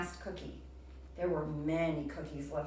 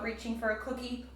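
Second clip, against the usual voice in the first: ordinary delivery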